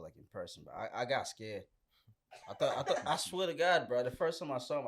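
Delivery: ordinary talk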